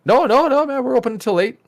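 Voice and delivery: high, loud mock shop guy voice; mock shop worker. Retelling the shop worker, high and mock-cheerful.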